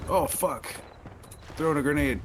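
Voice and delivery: sudden high alarmed shout; grenade warning yell. Real, not acted. Grenade incoming in combat.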